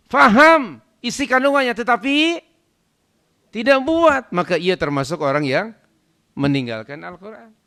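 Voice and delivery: high, loud declamation; full-force preaching. Real, not acted. Preacher listing who abandons the Quran, full-force preaching. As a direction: pitch it up and proclaim every word.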